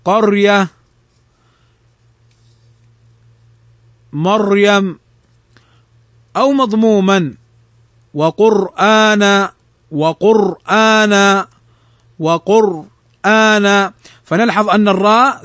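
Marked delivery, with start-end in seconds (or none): 0.0-5.0 قرية and مريم with a thick, closed r; heavy ra. Shown as a demonstration. Teacher models قرية and مريم, heavy still ra. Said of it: "فتفخم". 8.1-13.9 slow وقرآن, thick r before a long held vowel; heavy ra. Shown as a demonstration. Teacher repeats وقرآن for students, slow heavy ra. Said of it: "فهنا تفخم".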